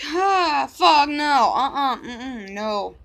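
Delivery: loud no, with a laughing sob; loud flat refusal. Retold or imitated. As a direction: shout it down, half laughing half crying. Refusing to testify against abuser.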